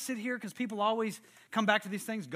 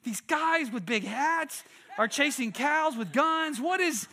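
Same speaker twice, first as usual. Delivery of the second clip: high, incredulous outburst, building louder; baffled kids; 7 semitones higher, 5 dB louder, slower than usual. Retold or imitated. Orphans hearing about armed cowboys, imitated bewildered disbelief. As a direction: mimic utter bewilderment, stretching the words. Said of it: "the kids are like".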